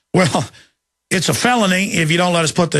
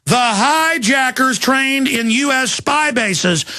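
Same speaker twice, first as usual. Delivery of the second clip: slow, higher, deliberate restatement; repeated for emphasis; 4 semitones higher, much slower than usual. Real, not acted. Host repeating his claim to listeners, slow deliberate emphasis. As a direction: hammer each word home slowly. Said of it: "Let me say that again".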